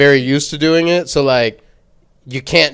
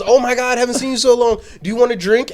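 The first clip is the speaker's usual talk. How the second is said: mock bubbly friend; 8 semitones higher than usual. Retelling friend's bubbly offer.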